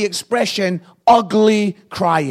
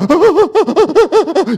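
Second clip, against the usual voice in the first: loud, high stuttering fake sobs; hammed-up crying with weird pulsing noises; mock sobbing; 9 semitones higher, 6 dB louder than usual. Father re-enacting his tears at the barber's, loud mock sobs. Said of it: "you're crying and you're making weird noises".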